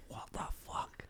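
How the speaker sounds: breathless whispered disbelief; incredulous whisper. Real, not acted. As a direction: whisper it in shock. Disbelief at Boris as PM.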